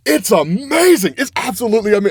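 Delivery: excited rave, cut short; real excitement. Real, not acted. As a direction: gush about it, pitch riding high. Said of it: "I'm sorry, I'm so excited".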